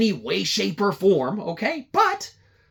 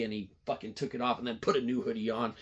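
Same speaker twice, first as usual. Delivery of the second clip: ordinary talk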